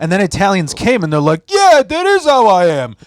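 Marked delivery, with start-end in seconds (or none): 1.5-3.0 high, loud mock Italian accent. Mocking Italian stereotype quote.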